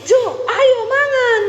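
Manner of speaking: high, stretched summons to a meal; Sulawesi-style calling out. Retold or imitated. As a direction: imitate her own high-pitched call. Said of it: "saya tuh panggil dia".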